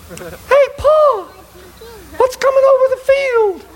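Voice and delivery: put-on very high boyish holler; boy calling out. Boy wakes and hollers to his father, high alarmed calling. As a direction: call to Pa in a high child's voice.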